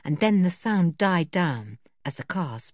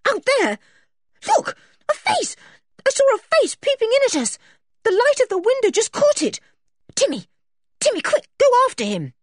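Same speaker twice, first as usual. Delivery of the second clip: high, loud, urgent shouts; frantic high-pitched outburst; alarmed shouting; 5 dB louder than usual. A narrator's reading. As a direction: shout the alarm, then send the dog off fast. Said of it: "leapt up, shouting".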